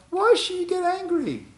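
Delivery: mock Moshe asking God why so angry, high and disbelieving; sarcastic high question; mock incredulous.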